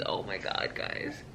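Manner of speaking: very soft, voiceless exclamation; overcome whisper. Real, not acted. Reader overwhelmed by a book to her viewers, whispering. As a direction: confide it to viewers, barely audible.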